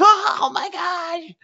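mock cold squeal. People tiptoeing into the pool, squealing at the cold. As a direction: ham up the shock of freezing water, stretched high. You inching into cold water.

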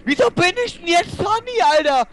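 high-pitched exasperated rant; outraged gamer whine; angry outburst. Real, not acted. Ranting at game.

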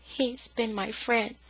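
soft, slow and faint. Acted. Cowering sister answering her brother, faintly. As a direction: barely let it out. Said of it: "Faintly, her voice came".

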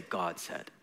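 tossed-off God said, low and soft; casual. Shown as a demonstration. Mocking how believers casually say it, offhand and soft. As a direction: throw the words God said away carelessly. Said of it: "And we casually say".

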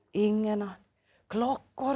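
soft hushed aside, as Otto; whispered by Otto. Acted. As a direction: lean in and say it softly. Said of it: "viskar Otto". (viskande som Otto)

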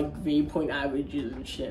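usual speaking voice

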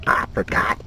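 usual speaking voice

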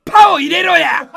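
loud, slowed laughing yell; hammed-up comic rage; mock angry demand. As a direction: bark the correction for laughs. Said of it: "切れる生配信".

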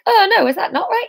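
a hint of alarmed audience; mock panicked listeners. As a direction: imitate fretting listeners. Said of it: "Suddenly they're like".

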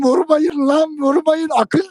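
high, loud pleading yell; pleading shout. Retold or imitated. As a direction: beg them to stop hitting, yelling. (yüksek sesle yalvaran bağırış)

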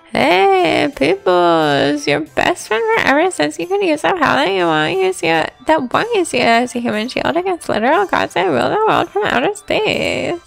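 high, bouncy silly clown. Acted. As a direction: ham it up like a party clown. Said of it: "speaks in a clown voice".

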